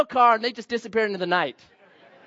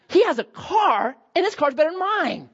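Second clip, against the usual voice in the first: stunned realization; 7 semitones higher than usual. Retold or imitated. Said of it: "I'm like".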